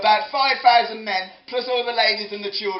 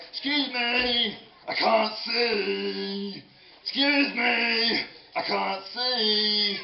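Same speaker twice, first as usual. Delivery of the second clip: very slow, drawn-out plea; little boy calling; much slower than usual. Acted. Little boy stuck behind the crowd, drawn-out calling. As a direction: stretch every word like a small child. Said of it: "imagine this little, little boy sort of turning up".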